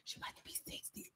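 breathy whispered aside; whispered aside about her mother's age. Real, not acted. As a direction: whisper it like a secret.

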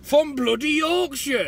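high, loud, drawn-out mock Yorkshire; attempted Yorkshire accent. Mimicking his mate's bad attempt, slow loud Yorkshire accent. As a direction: echo it in a hammed-up Yorkshire accent. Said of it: "I can't even put a Yorkshire accent".

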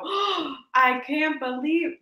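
drawn-out, put-on disbelief; mock shocked gasp. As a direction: gasp it like a hammed-up twist reveal. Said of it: "I'm gonna go".

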